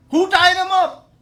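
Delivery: high, loud angry shout; outraged demand. Acted. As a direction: explode with an indignant question. Balram demands the culprit.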